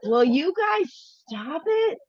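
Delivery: high, drawn-out plea to quit; real exasperated telling-off; exasperated scolding. Real, not acted. Telling off others at home mid-call.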